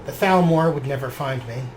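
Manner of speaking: measured, reflective diary reading; journal narration. A narrator's reading. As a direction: read it like a character's private journal. Reading his character's journal aloud.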